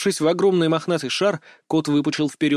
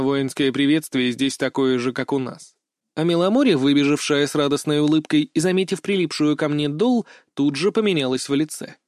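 ordinary delivery

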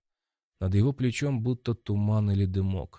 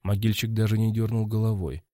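plain reading voice

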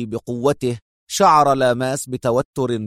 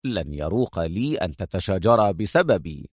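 low, soft, dry mockery; calmly mock their quarrel; stern irony; stern and sarcastic; 5 semitones lower, 4 dB quieter than usual. A narrator's reading. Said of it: "جمعت بين صرامته وسخريته".

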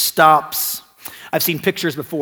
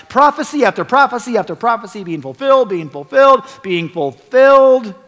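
louder, higher preaching build; rising sermon; 3 semitones higher, 5 dB louder than usual. Real, not acted. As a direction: drive it home to the congregation. Preaching at full force.

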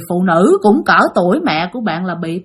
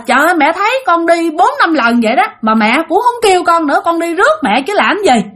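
retold higher-pitched complaint, mildly annoyed; resentful grumbling; 7 semitones higher than usual. Child protests having come to pick mother up, mild resentment. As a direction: act out a child's put-out protest. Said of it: "bực bội, bạn hằn học".

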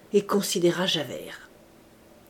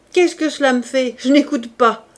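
loud, raised-pitch refusal; angry dismissal; 5 semitones higher, 7 dB louder than usual. A narrator's reading. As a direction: brush the plea off forcefully. Javert rejects the plea.

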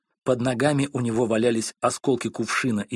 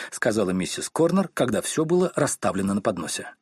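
usual speaking voice

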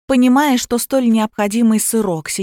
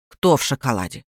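low-pitched, puzzled aunt voice; gruff confused aunt; 8 semitones lower than usual. A narrator's reading. Aunt echoes the stranger's words, low and baffled. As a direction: drop the voice low for a baffled question.

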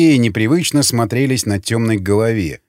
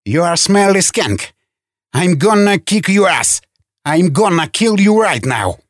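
higher-pitched menacing rant, volume held back; yelled threats; 5 semitones higher than usual. A narrator's reading. Man waving a pistol at caged prisoners, yelled threats. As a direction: threaten them like you mean it. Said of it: "орать угрозы".

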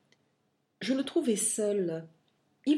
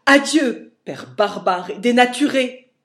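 loud, higher impassioned shout; dans mon transport; 5 semitones higher, 12 dB louder than usual. A narrator's reading. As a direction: fling the words in fury. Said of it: "lui dis-je dans mon transport". (cri furieux et passionné)

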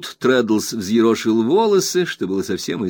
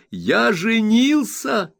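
high, slow proud exclamation; spring the big news with pride; Traddles announcing; 12 semitones higher, 3 dB louder, much slower than usual. A narrator's reading. Traddles telling Copperfield he is married, high and proud. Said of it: "радостно воскликнул я".

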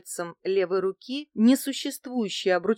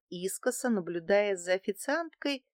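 ordinary delivery

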